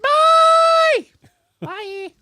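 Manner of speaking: silly put-on lady voice; goofy character voice. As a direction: play a goofy woman character. Put-on voice, loud goodbyes.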